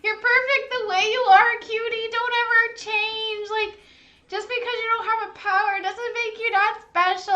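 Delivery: tender. Retold or imitated. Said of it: "I was like".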